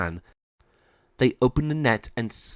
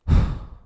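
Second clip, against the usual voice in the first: sigh; 4 dB louder than usual. A narrator's reading. Monty sighs in cage.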